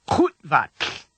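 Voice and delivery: Kutvat with throaty k, stopped short after Kut; abrupt mid-word cut-off. Shown as a demonstration. Teacher has learners listen to the word, Kutvat cut off mid-word. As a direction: break Kutvat sharply in the middle. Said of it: "cut off abruptly in the middle".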